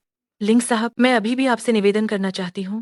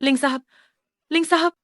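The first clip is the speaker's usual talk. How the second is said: calling after someone; 5 semitones higher than usual. Acted. Woman calling after the man she needs a deal from, pitched up. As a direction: call the name, lifting it higher.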